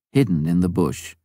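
plain reading voice

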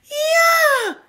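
very high, loud, joyful yell; happy shout. A narrator's reading. Big realises he is swimming, happy shout. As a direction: cheer it out with delight. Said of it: "roept Big blij".

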